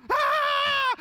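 loud, very high-pitched mock shriek; girlish scream. Dad imitating his son launched in the air, girlish scream. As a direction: imitate a piercing, sustained scream. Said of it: "was screaming like a girl".

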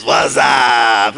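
low, loose catchphrase holler; catchphrase imitation. Recalling what everybody said for two years, hollered catchphrase. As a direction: draw it out like the ad. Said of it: "that's all anybody said for two years".

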